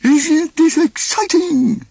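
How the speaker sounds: high, loud, slow goofy gush; Goofy enthusing to grumpy Donald. A narrator's reading. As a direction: gush like a happy goof. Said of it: "Goofy enthused".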